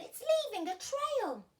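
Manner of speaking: very high, loud call; excited children. A narrator's reading. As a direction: point out something amazing. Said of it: "says the children".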